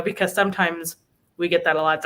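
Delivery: normal talking voice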